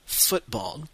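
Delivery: football in a British accent, FOOT-ball; football with an English accent for the class. Shown as a demonstration. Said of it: "avec un accent anglais".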